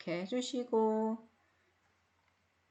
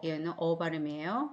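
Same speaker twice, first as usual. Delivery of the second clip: normal talking voice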